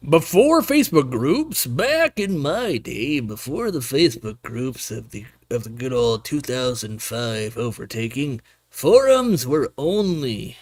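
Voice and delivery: put-on slow, creaky old-timer voice; old man voice. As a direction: talk like a grumpy old geezer. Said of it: "You've got a great old man voice".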